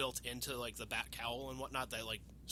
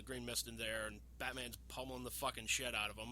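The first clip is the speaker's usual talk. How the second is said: ordinary talk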